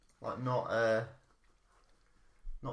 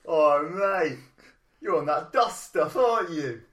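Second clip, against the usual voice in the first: loud, higher bloke on the street; average guy in the streets voice; 6 semitones higher, 11 dB louder, slower than usual. Retold or imitated. Stranger mocks a mumbling walker, loud, high street bloke voice. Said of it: "average guy in the streets voice".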